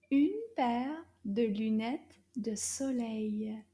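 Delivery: slow careful French repetition; slow repeat model. Shown as a demonstration. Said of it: "Can you repeat that?".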